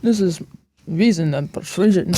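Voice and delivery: light mock Connery Scottish accent; a hint of Sean Connery's Scottish voice; Sean Connery impression. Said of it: "sound like Sean Connery".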